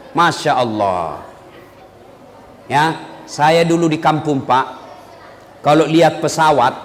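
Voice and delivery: usual speaking voice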